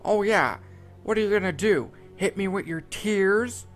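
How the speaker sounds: mocking taunt. A narrator's reading. Squidward mocking the crying SpongeBob, loud sneering taunt. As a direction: taunt him like he's a joke. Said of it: "Squidward said, laughing".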